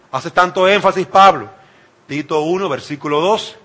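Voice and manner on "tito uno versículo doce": ordinary delivery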